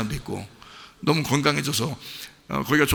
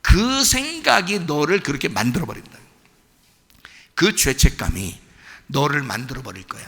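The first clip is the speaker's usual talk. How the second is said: ordinary talk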